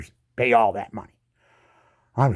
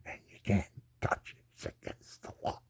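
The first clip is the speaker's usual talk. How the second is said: softer, whispered taunt; 5 semitones lower, 14 dB quieter than usual. Real, not acted. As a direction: whisper it like a secret jab.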